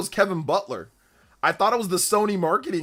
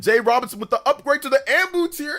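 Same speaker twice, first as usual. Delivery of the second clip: high-pitched excited announcer yell; hyped shout-out; 12 semitones higher, 4 dB louder than usual. Real, not acted. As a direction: celebrate the upgrade in a high, excited voice. Hyped thanks for a subscriber upgrade.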